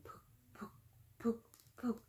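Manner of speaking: breathy whispered pook, pook sounds; teacher miming a low rumble for kids. Shown as a demonstration. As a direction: whisper the rumble like a distant drum. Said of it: "A rumbling is a constant low tone".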